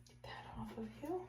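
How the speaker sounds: soft whisper to a pet. Real, not acted. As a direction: whisper it gently. Soothing kitten during flea bath.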